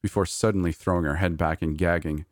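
ordinary delivery

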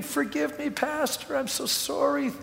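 hint at his weeping while quoting him; a touch of tearful apology, imitated; mild retold weeping. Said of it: "he came around weeping".

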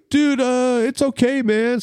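high-pitched dopey brush-off; drunk friends. Retold or imitated. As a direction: imitate tipsy pals waving off a worry. Drunk friends brushing off his warning.

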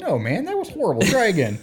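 high-pitched, exasperated verdict; playful high protest; mock dismay. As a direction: reject it in exaggerated, teasing horror. Retold teasing verdict on the accent.

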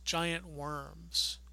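usual speaking voice

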